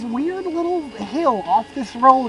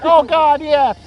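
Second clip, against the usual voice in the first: loud, higher-pitched mock excitement; eager yes; 6 semitones higher, 8 dB louder, slower than usual. Storyteller replaying his reply to the adventure, excited. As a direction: burst out with excited agreement.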